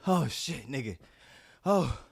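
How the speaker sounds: ordinary delivery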